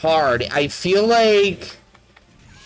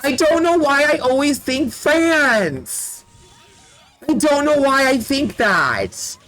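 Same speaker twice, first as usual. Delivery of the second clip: high, half-laughing whine; exasperated lament; 6 semitones higher than usual. Real, not acted. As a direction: wail helplessly at your own mistake. Laments own repeated mistake.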